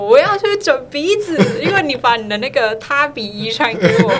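high, loud, laughing whine; laughing mock demand. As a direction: whine it like a spoiled kid, laughing. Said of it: "跟我妈扬言说".

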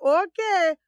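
put-on Caribbean flavor; imitated Caribbean accent. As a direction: lightly mimic a Caribbean speaker. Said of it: "speak to someone that's Caribbean".

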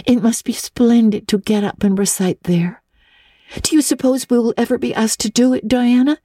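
put-on a dreamy girl marvelling at the night; eager girl Anne. A narrator's reading. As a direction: Anne whispering her wonder to Diana.

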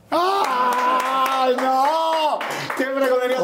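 high, amazed exclamation; excited. Real, not acted. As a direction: react with big delight. Excited reaction in interview.

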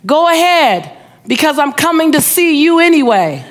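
acted holler across traffic; retold calling out. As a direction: call it out cheerfully to the next car. Driver calls from car window.

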